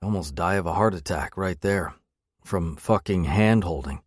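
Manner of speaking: plain reading voice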